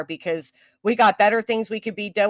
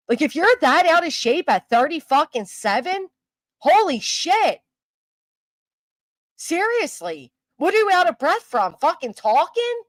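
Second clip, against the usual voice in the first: mocking disbelief, higher; scornful; 7 semitones higher than usual. Real, not acted. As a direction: sneer at her, voice rising. Ranting about Brittany's breathing.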